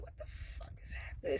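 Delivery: hushed, unvoiced disbelief; whisper. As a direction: breathe it out in stunned silence. Everybody just looking at her like.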